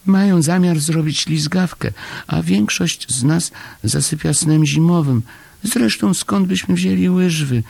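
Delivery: very high, plaintive voice; dejected little Chomek. A narrator's reading. Chomek bringing Paszczak bad news, high dejected voice. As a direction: complain high and small. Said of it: "Był przygnębiony".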